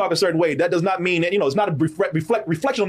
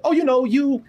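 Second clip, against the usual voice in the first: higher mock voice, patronising; imitating the woman; 8 semitones higher than usual. Quoting the woman who says he talks normal, higher mock voice. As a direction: lift the pitch and mimic her praise. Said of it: "She said".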